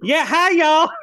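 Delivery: breathy glamorous Southern belle; quick flirty Southern starlet greeting; Southern Marilyn Monroe. Retold or imitated. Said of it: "It's like a Southern Marilyn Monroe".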